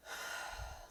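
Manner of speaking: long breathy sigh at someone; imitated heavy sigh; exasperated sigh. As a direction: let out a pointed sigh. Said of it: "sighs in my face".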